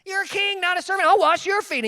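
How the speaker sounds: high-pitched, insistent objection; acted dismay, pitched well up; protesting Peter. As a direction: protest like a scandalized disciple. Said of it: "And Peter would say".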